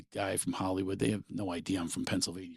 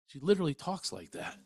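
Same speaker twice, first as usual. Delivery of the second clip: ordinary delivery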